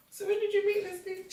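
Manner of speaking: high and soft, fishing for gossip; nosy question. Retold or imitated. Friend quizzing about the new guy, high and hushed. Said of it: "I'm like".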